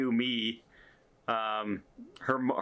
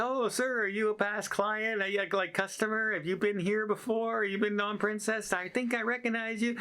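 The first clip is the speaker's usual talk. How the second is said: higher, overly polite guessing questions; mock polite worker; 11 semitones higher than usual. Imagined crew man dancing around the question, voiced higher. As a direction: fish for it politely, voice raised.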